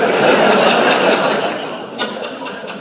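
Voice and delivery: loud high laughing fit; loud laughter. Real, not acted. Laughing loudly at the end of the disastrous date story.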